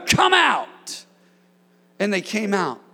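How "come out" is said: high, loud rebuke; sudden commanding shout. Acted. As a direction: shout the one word that casts out. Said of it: "One word".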